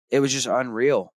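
usual speaking voice

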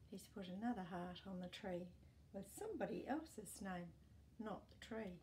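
ordinary delivery, nothing marked

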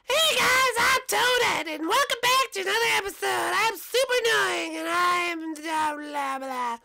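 super annoying, piercingly high squeak; very high, loud, drawn-out cartoon squeal; Toadette. Shown as a demonstration. Toadette welcomes viewers back, super annoying voice. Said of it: "So Toadette sounds like".

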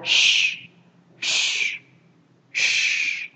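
long voiceless shushing sound; hissed SH. Shown as a demonstration. Teacher demonstrating the sound to students, long hissed SH. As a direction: hiss out "sh" with no vowel. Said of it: "các bạn xì ra".

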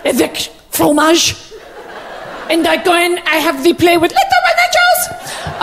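hammed-up French-Canadian accent; halting French-accented English of a doting diva mother. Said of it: "Je suis [name]".

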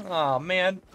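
ordinary delivery

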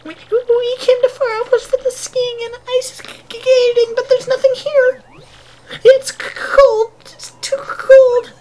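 squeaky, very high falsetto, stuttering with cold; shivering traveling sisters. Acted. Sisters complain about the empty cold outpost, squeaky shivering voice. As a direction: squeal it out through chattering shivers.

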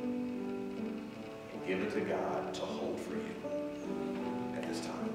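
slow, soft meditation voice; guided meditation. Acted. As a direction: guide them into stillness, slowly.

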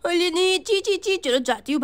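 normal talking voice

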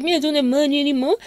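ordinary delivery

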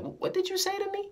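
very high, incredulous question; mock astonishment. Customer questioning the cashier's thanks, high-pitched disbelief. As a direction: imitate someone stunned, pitch way up. Acts out customer's stunned reply.

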